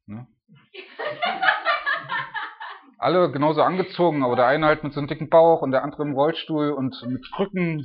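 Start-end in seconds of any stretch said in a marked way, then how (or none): 0.7-2.8 a burst of high, hearty laughter. Real, not acted. Loud laugh at absurd picture.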